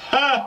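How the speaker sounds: single high bark of a laugh; short high mock laugh. Testing laugh button.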